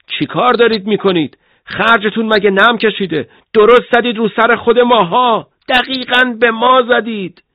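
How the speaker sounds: loud, high-pitched scolding over the radio; angry. A narrator's reading. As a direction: shout an outraged complaint at the gun crew. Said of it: "ستوان کمی عصبانی شده بود".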